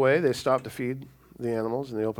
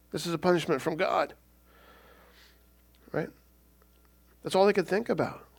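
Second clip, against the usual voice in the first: usual speaking voice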